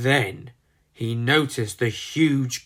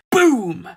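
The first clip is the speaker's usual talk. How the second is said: loud, higher booming blast; explosion boom; 8 semitones higher, 5 dB louder than usual. Acted. Narrator voicing the huge explosion, loud boom. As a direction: burst out with it like an explosion.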